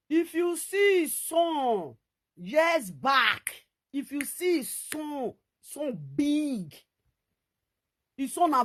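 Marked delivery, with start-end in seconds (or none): 0.1-6.0 very slow, higher, drawn-out exclaim; amazed exclaim. Real, not acted. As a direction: stretch it out in disbelief. Marvelling at son's size.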